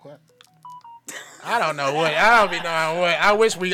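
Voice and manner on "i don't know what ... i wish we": much louder, exasperated outburst; loud exasperation. Real, not acted. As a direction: blurt it out loudly, at a total loss. Baffled at co-host's lost word.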